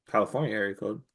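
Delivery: normal talking voice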